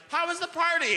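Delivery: mock parent checking on the kid; parent's voice.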